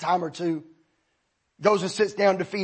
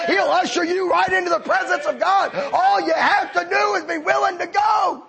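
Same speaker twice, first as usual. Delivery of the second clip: higher, louder, building preacher's climax; preaching at full force; 11 semitones higher, 5 dB louder than usual. Acted. Preacher bringing the Lincoln story home to the congregation, rising to a shout. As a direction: carry the church up to the shout at the end.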